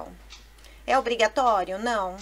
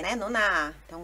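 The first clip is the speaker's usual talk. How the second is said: ordinary talk, nothing marked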